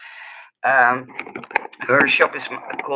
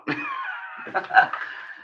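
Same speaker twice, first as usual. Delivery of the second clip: squeaky laugh. Real, not acted. Laughing at her own lost voice.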